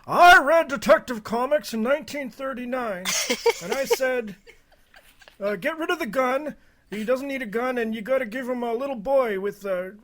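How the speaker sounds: put-on high, loud creaky old-timer; old man voice. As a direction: play a doddering old man reminiscing. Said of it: "That's my old man voice".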